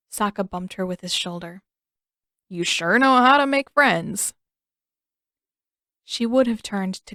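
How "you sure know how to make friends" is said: slow, loud mocking drawl; sarcastic tease. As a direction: needle your sister sarcastically. Sokka teasing his sister.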